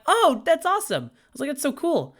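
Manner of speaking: high, loud excited squeal. Retold or imitated. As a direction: gush with delight. Excited reply to student.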